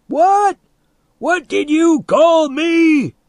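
very high, loud indignant yell; outraged shout. A narrator's reading. Insulted man demands it be repeated, outraged yelling. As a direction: shriek it slowly in disbelief.

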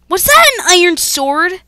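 loud, high disbelieving question; incredulous. Real, not acted. Gamer just killed by an iron sword, incredulous shout.

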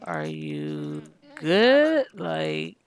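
deep slow put-on husband voice; mock deep husband. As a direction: drop very low and slow. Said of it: "He's like".

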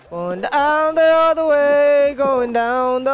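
high, slow, stretched-out calling; drawn-out cry. Real, not acted. Player going down the other way, drawn-out cry. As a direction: draw the words out as you cry out.

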